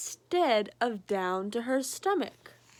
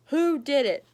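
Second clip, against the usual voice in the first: higher, louder stern question; mock stern parent; demanding parent; 4 semitones higher, 7 dB louder than usual. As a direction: ask who's to blame.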